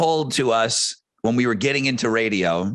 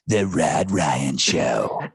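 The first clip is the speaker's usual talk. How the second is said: deep, slow announcer; imitating a drawn-out imaging voice; big radio voice; 10 semitones lower, much slower than usual. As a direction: stretch it low like a station ID. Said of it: "Do the thing and the thing".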